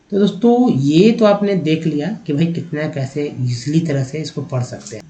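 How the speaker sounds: normal talking voice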